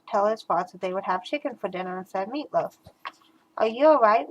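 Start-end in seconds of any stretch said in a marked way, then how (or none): none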